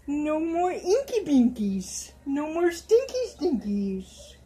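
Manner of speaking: singsong nursery coo; slow sing-song baby talk. Acted. Mother cooing to her doll, slow sing-song baby talk.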